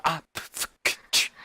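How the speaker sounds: spluttered beatboxing consonants; imitated beatbox stutter. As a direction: imitate a flustered stutter as beatbox puffs and clicks. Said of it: "What about the beatboxing?".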